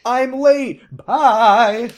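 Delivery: loud, high-pitched hammed-up farewell; exaggerated sing-out goodbye; mock breezy exit. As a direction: call it out as you bolt. Acted abrupt exit after reveal.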